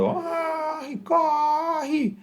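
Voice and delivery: high, drawn-out yell at held-back volume; mock warning shout. Teacher acting out the worker's shout as a sound wave, held-back mock yell. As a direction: imitate a far-off cry, high and stretched. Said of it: "Aí ele grita".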